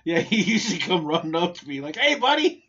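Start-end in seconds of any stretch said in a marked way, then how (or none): 2.0-2.6 high, loud happy "Hey, buddy!"; excited kid greeting. Retold or imitated. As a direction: call out like a thrilled child. Kid running up to greet him.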